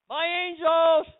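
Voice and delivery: loud, high preacher's shout; ringing, triumphant cry; triumphant declaration. Acted. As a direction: proclaim it at full force. God's words declared triumphantly.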